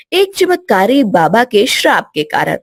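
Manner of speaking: ordinary delivery, nothing marked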